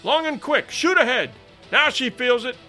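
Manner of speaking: high, loud broken shouts; cheering exclamations. A narrator's reading. Boat officer cheering his rowers after the whale, excited shouting. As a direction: urge the rowers on, burst by burst. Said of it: "broken exclamations and adjurations with which I cheered".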